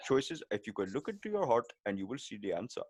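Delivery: Apu. Acted. Guru telling a seeker to look within, cartoon Indian accent. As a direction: deliver mock wisdom in a cartoon Indian accent. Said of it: "an Indian accent".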